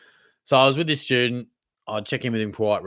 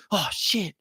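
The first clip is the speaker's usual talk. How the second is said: higher, hushed mock panic; imitating panicked student; 7 semitones higher than usual. Student on night walk spooked by silhouette, higher mock panic. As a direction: act out a spooked kid seeing a shape. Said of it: "he's, like, panicked".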